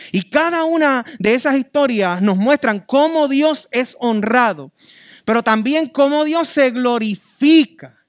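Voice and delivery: preacher hammering on constancy; fervent preaching. Acted. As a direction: drive each point home from the pulpit. Preaching at full force.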